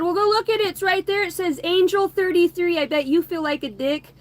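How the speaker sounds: high, loud retort from a retold quarrel; defiant comeback.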